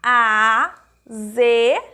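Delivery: "azedo" very slow as a, ze, do, with pauses; syllable by syllable. Shown as a demonstration. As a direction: stretch and separate each syllable of "azedo". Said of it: "se eu pronuncio a palavra". ("azedo" bem devagar, sílaba por sílaba)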